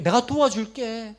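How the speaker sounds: a comforting believer vowing help; rising fervent promise. Acted. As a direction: build the promise with conviction.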